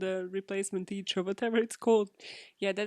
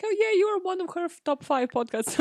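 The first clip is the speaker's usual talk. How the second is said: put-on chirpy teen; 9 semitones higher than usual. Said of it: "It was like".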